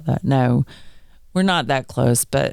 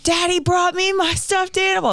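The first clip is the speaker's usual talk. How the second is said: put-on high, proud little kid voice; little girl voice. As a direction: chirp it like a proud toddler. Daughter quoted, high child voice.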